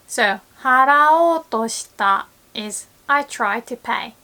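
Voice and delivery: normal talking voice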